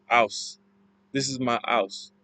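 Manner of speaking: "ouse" with no H at the start; dropped H. Shown as a demonstration. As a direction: drop the H in "ouse", Cockney style. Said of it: "dropping the H".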